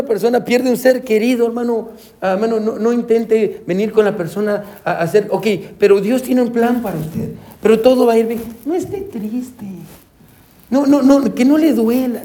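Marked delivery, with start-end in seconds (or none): 5.3-12.2 higher, lightly voiced well-meaning consoler; a hint of a fussy comforter, rising higher; mock pushy comforter. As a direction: parody someone rushing a mourner out of grief. Mocking pushy comforter at funeral.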